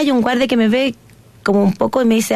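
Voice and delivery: normal talking voice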